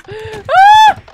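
very high, loud shriek; piercing yelp of fear; frightened scream. Real, not acted. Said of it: "That's so scary!".

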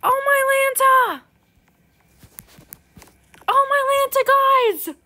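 high, loud, stretched-out oh my Lanta; excited shriek. Real, not acted. Gamer amazed by a rare pet, shrieking excitedly. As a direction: squeal it with amazed delight. Excited at seeing something amazing.

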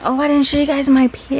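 ordinary delivery

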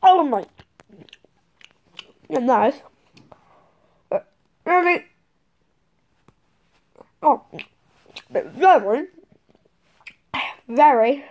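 chewing while talking; speaking with candy between teeth; mouth full of gummy. Real, not acted. Seen 1: Bites and pulls red gummy worm with teeth, chewing throughout.

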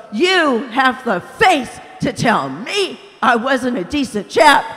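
indignant shout. A narrator's reading. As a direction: hurl the insult back at him. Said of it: "gasped the ghost".

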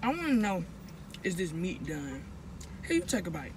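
speaking between bites; talking while chewing. Real, not acted. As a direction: mouth partly full of food. Seen 0: Bites burger just before; jaw and cheeks appear to move while speaking.

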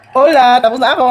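loud victorious yell; triumphant shout. Real, not acted. Finished eating the spicy dish.

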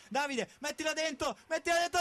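rising urgent plea; urging shout. Real, not acted. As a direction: urge him to score. Urging player to shoot.

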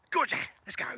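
bright sudden go; excited release. Real, not acted. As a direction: cheer the dog on. Releasing the dog.

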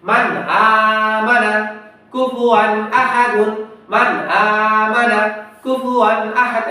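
drawn-out chanted recitation, each syllable held; slow Quranic recitation. Shown as a demonstration. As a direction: recite slowly in tajweed style, stretching the vowels. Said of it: "আবার দুটো একসাথে পড়বো".

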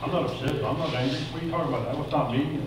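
hushed mock denial, well under normal volume; defensive denial. Person under attack insisting they're not upset, quietly defensive. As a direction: softly imitate someone minimizing. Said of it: "he begins to defend, rationalize, and minimize".

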